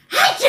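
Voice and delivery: sharp loud achoo; loud fake sneeze. Shown as a demonstration. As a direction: burst out a big sneeze. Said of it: "하고 이렇게".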